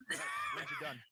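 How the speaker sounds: very high put-on voice; character voice. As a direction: squeak it out as Ezra. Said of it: "Ezra looks over".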